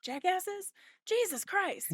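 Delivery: high, fed-up jab; teasing the friends who keep interrupting; exasperated. Real, not acted. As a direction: toss the insult in mock annoyance.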